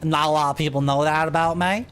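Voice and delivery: high, hammed-up Southern country girl; super southern accent. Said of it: "until we get super southern accents".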